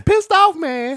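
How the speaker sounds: high, loud Chris Tucker yelp; squealing Chris Tucker catchphrase; Chris Tucker impression. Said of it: "Like Chris Tucker".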